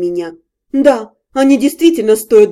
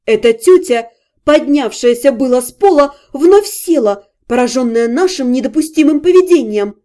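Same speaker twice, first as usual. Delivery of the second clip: plain reading voice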